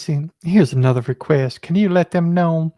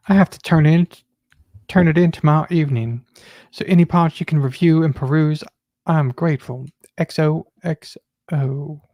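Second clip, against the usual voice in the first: reading a message aloud. Acted. Coworker reads Valerie's note aloud to the office. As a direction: read it out for everyone to hear.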